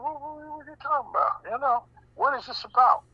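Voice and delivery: normal talking voice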